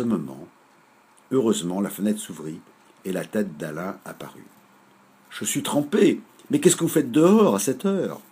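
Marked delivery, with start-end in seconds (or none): 5.3-8.2 surprised. Acted. Alain surprised at window.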